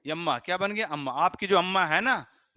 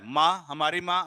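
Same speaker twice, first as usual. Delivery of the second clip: normal talking voice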